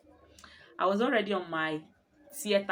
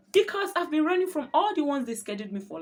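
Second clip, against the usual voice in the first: higher and louder, rushing the confession; excited; 9 semitones higher, 7 dB louder than usual. Real, not acted. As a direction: blurt it out, pitched up and loud.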